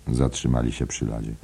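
plain reading voice

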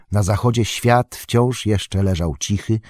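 usual speaking voice

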